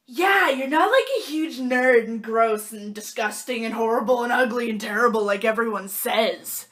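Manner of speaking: imitating Seaham. Seaham lists what everyone says about her, cheerful rattle. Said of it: "And she said".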